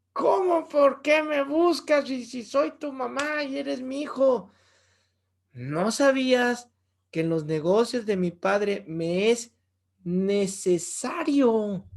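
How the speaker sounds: high, slow, mock-indignant mother; Mary retorting to young Jesus, half-joking. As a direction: bristle at the question like an offended mom.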